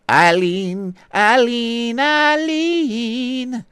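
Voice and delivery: rising sing-song name call. Acted.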